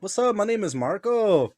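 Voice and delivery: high smooth greeting; imitating smooth Marco. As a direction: play the charming ladies' man. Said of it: "always like".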